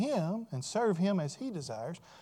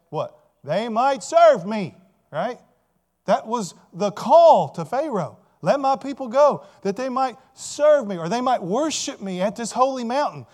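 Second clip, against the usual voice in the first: loud preaching that climbs higher as it builds; forceful preaching; 4 semitones higher, 9 dB louder than usual. Real, not acted. Preacher telling the congregation why God saves his people, forceful preaching. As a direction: drive the key words home with full pulpit force.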